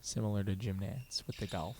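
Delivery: very soft golf hush; whisper-soft like a golf booth; golf announcer voice. Acted. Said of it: "golf announcer voice".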